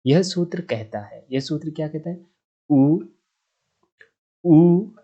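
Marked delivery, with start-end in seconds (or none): 2.7-3.0 उ said short, one quick beat. Shown as a demonstration. Teacher demonstrating the short vowel उ. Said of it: "के उच्चारण में क्रम से". 4.4-4.9 उ held for two beats, louder; long u. Shown as a demonstration. Teacher showing students the long vowel उ. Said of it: "के उच्चारण में क्रम से".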